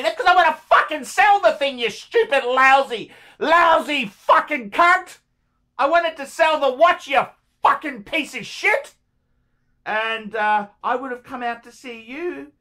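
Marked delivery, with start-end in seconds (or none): 0.0-8.9 high, loud exasperated rant; angry outburst. Real, not acted. Seller venting at a timewasting buyer, angry outburst. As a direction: let the insults fly, real anger.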